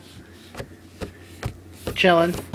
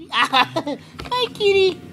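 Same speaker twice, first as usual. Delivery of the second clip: much higher, cooing kitty voice; 12 semitones higher, much slower than usual. Real, not acted. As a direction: coo at the kitten like a pet owner. Greeting a kitten in baby talk.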